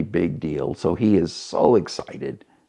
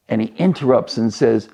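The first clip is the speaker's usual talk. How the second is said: ordinary talk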